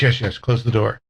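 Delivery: normal talking voice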